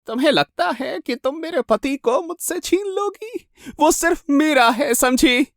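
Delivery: high, slow, menacing madwoman; crazed jealous wife. Acted. Jealous wife warning a supposed rival, crazed and high. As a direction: draw out each threat possessively, pitched high.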